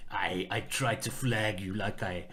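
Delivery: low, slow put-on French accent; hammed-up, not very convincing French accent; bad French accent. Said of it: "That's my French accent".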